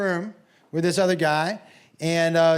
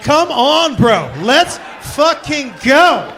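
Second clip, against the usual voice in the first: 1 frustrated shout; 5 semitones higher, 9 dB louder, much slower than usual. Retold or imitated. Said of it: "I almost picked one dude up and was like".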